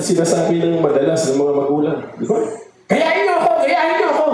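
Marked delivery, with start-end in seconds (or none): 2.9-4.2 high, mock-angry parent yelling. Parent scolding the children to copy them, mock angry. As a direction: imitate a parent snapping at the kids to copy them. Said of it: "Pero galit".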